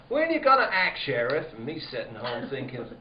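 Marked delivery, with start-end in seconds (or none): 0.1-1.5 high, loud nagging mimic of the prosecutor; mimicking prosecutor. Sheriff mimics the prosecutor nagging him, high and loud. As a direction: throw the prosecutor's demand back, louder. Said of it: "prosecutor after me".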